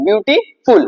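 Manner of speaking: বিউটিফুল broken after বিউটি, with a short gap before ফুল; syllable demo. Shown as a demonstration. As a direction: pronounce বিউটিফুল in two distinct beats. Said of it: "দুইবারে উচ্চারণ হচ্ছে".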